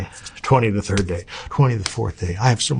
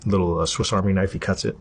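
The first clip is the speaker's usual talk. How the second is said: usual speaking voice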